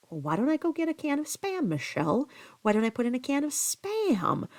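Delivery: high, sing-song joking voice; playful imitation of a joking husband; mock teasing suggestion. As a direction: tease her, swooping up on Spam.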